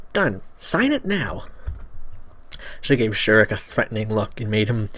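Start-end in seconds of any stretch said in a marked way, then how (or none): 0.1-1.4 put-on firm girl voice. Girl insists firmly.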